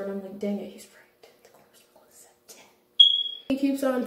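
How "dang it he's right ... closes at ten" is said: hushed inner thought. Retold or imitated. She admits defeat to herself in a near whisper. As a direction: drop to a near whisper, thinking aloud.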